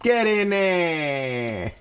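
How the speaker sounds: drawn-out cheer. Acted. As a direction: coax the food into the pan slowly. Tossing food into pan.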